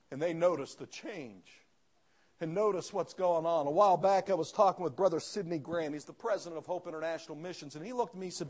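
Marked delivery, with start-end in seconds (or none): none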